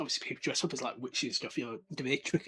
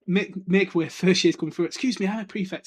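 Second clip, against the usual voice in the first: louder self-important calling out; pompous Percy; 4 semitones higher, 7 dB louder than usual. Retold or imitated. Percy clearing a path, pompous call. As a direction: push through the crowd. Percy bossing through crowd.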